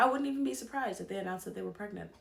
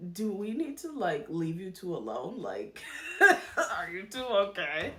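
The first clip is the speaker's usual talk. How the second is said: laughing disbelief; 4 dB louder than usual. Retold or imitated. Vlogger mocking the flirting couple, laughing in disbelief. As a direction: ask it while cracking up at the couple. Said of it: "it was like".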